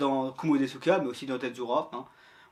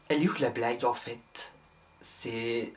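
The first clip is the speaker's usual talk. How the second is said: lower, deadpan voice-off needling the host; a dry, low offscreen critic; voice-off heckler; 7 semitones lower, 6 dB quieter than usual. Acted. Voice-off mocking the host's joke, low and dry. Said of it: "Tu es une voix off".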